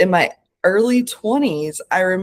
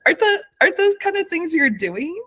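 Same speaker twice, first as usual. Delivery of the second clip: much higher, lilting and tender imitation; gentle, playful coaxing; 8 semitones higher, faster than usual. Therapist gently teasing the doubting client, high and playful. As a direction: coax her kindly, teasing a little. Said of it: "very gently and lovingly, you know, and playfully".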